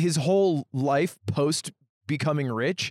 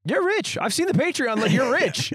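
mocking Phil; 9 semitones higher than usual. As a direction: squeak it out like you're ribbing a rich buddy. Retelling Phil's high mocking quote.

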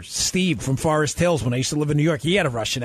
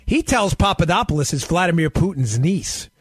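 ordinary talk, nothing marked

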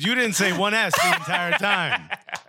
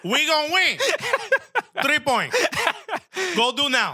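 put-on Cuban accent; 11 semitones higher than usual. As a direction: fire up teammates in a thick Cuban accent. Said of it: "In the strongest Cuban accent you've ever heard".

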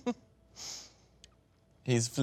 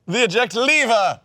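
drawn-out panicked shout, louder and higher; frantic yell; 11 semitones higher, 9 dB louder, much slower than usual. Pretend. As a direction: bellow it at the screen in panic. Yelling at the movie screen.

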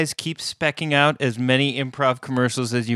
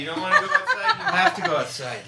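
laughing; faster than usual. Real, not acted. Owner laughing while coaxing his dog outside. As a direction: giggle through coaxing the pet.

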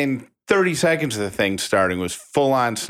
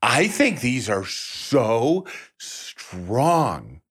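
slow; much slower than usual. Retold or imitated. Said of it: "she goes".

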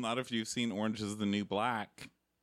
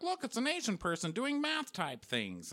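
higher mock cop voice; mock detectives; 10 semitones higher than usual. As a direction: lampoon the TV detectives. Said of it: "They're like".

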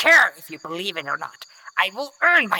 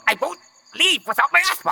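defiant; 9 semitones higher, faster than usual. Acted. Captive refusing to leave.

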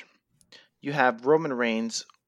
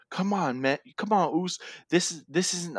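imitating a brother begging him, voice raised a bit; pleading brother; 6 semitones higher than usual. Wrestler begging his battered brother to stop, mock pleading. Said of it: "And he's pleading with him".

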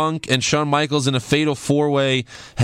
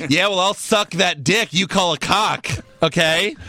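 shouted comeback; 6 semitones higher than usual. Pretend. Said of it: "I did Chris Chrisley".

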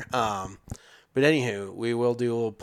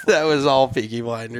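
laughing; 5 semitones higher, 7 dB louder than usual. Real, not acted. Laughing about the bad accent.

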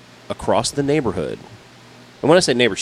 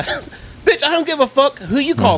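much higher, louder retold shout; furious yelling; 12 semitones higher, 5 dB louder than usual. Telling the neighbor the county won't care, yelling. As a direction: bark it right in her face.

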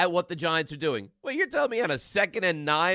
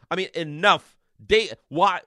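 usual speaking voice

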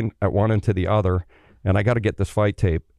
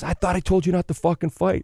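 mock angry coach; 9 semitones higher than usual. Coach scolding after fight.

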